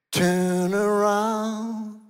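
very slow, drawn-out turn around; slow command. Acted. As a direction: stretch each word calmly. Command in sermon. (ganz langsam und gedehnt gesprochen)

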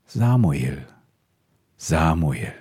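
put-on God calls the sleeping boy by name, slow and solemn; a solemn divine call, unhurried. God calls young Samuel in the night, solemn calling voice. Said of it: "rief der Herr zum dritten Mal".

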